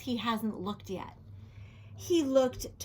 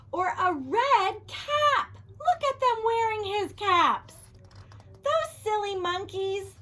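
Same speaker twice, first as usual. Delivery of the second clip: delighted; 8 semitones higher than usual. A narrator's reading. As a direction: marvel at the silly monkeys. Delighted reveal of monkeys.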